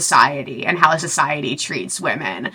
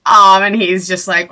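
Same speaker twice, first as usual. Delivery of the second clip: usual speaking voice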